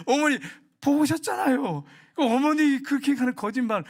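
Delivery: high-pitched mock protest of a wronged daughter-in-law; indignant protest. Daughter-in-law denies it before her husband, acted indignant protest.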